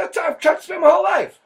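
high, loud indignant outburst; exasperated shout. Acted. Defending his accent to the host, indignant shout. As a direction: protest loudly that nothing changed.

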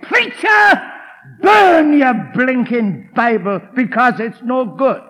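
loud heckler's snarl, slow; snarling shout. Retold or imitated. Heckler snarls at the preacher, shouting. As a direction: bark it at the preacher. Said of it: "and with a snarl, he said".

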